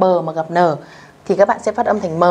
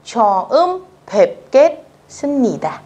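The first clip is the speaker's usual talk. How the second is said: 처음 뵙겠습니다 slow, syllable by syllable, higher; slow careful reading; 5 semitones higher than usual. Shown as a demonstration. Teacher modelling 처음 뵙겠습니다 slowly first. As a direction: spell out 처음 뵙겠습니다 for beginners. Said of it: "các bạn chú ý nhá".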